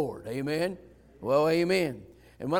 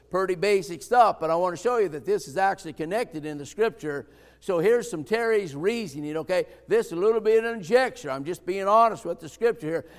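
ordinary talk, nothing marked

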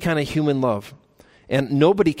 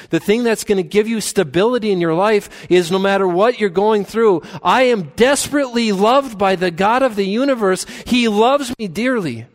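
higher, louder rising sermon climax; preaching at full force; 7 semitones higher, 4 dB louder than usual. Acted. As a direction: build it up like a pulpit crescendo.

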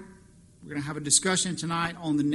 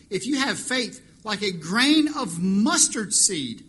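preaching; 3 semitones higher, 6 dB louder than usual. Real, not acted. Sermon preaching.

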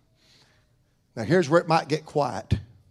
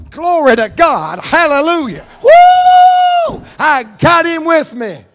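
higher and louder, whooping like a fan in the stands; rowdy football-fan praise; 11 semitones higher, 11 dB louder than usual. Retold or imitated. Preacher imitating an old-fashioned believer at a football game, whooping praise. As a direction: cheer it out with a big woo. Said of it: "an old-fashioned believer at a U T football game going".

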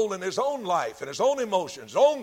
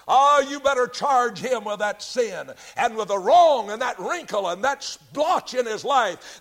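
accusing devil; 5 semitones higher, 5 dB louder than usual. Acted. Devil accuses believer before God.

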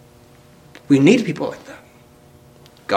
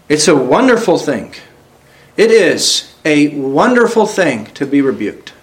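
usual speaking voice

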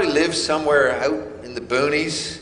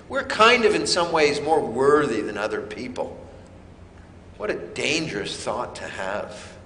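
normal talking voice

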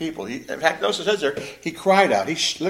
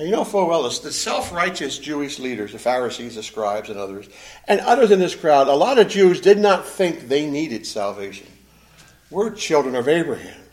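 normal talking voice

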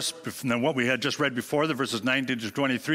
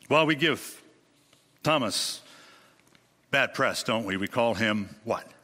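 normal talking voice

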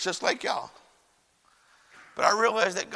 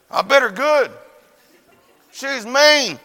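put-on loud, indignant little-kid confession; punchy self-justifying outburst; defiant child; 7 semitones higher, 9 dB louder, slower than usual. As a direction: blurt it out with no remorse. Child defiantly justifies biting sister.

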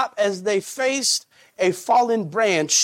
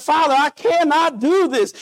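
tearful plea; 7 semitones higher, 3 dB louder than usual. Acted. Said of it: "With tears pouring down his face now".

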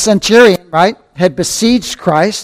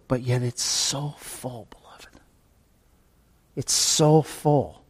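whispered, slow and hushed; hushed awe; 4 semitones lower, 11 dB quieter, slower than usual. Real, not acted. Preacher hushing his congregation in awe, whispered. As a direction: drop to an awed whisper.

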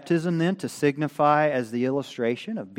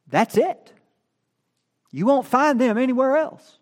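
emphatic; 11 semitones higher, 5 dB louder than usual. Acted. Emphatic about death.

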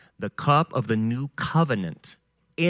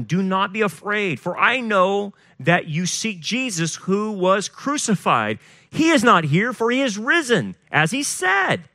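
higher, ringing scripture reading; scripture proclamation; 7 semitones higher than usual. A narrator's reading. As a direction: proclaim the angel's message, pitch climbing to the end. Proclaims the angel's scripture words.

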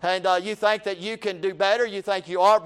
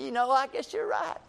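false modesty; 5 semitones higher, 6 dB quieter than usual. Retold or imitated. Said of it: "put a smile on your face".

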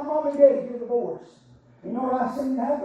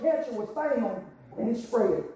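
ordinary delivery

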